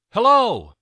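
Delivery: very loud, high call; girl yelling from the other side of the wall. A narrator's reading. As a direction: yell across to the other side. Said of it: "Julia yelled from the other side".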